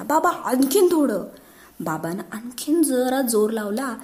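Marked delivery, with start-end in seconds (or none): 0.0-1.2 higher, excited egging on; cheering shout. Acted. Son cheering father lifting cupboard, excited higher shout. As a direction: cheer dad on. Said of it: "Raju ओरडला".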